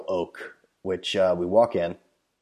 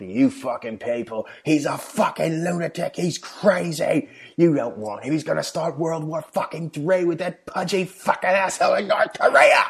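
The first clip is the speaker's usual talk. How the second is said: higher, ranting Scottish voice; mock Scottish tirade, pitched up, slowed on crazy; Scottish drunk ranting; 9 semitones higher than usual. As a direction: imitate the old Scot shouting about Trump. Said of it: "he starts yelling at me".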